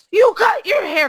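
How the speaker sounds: high, loud accusing cry of you cut your hair; mock the shocked accusing teacher she avoids; accusing shriek. To the girls, a high loud shocked accusation. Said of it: "not to be like".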